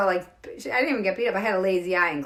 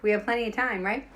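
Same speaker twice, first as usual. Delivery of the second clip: ordinary talk, nothing marked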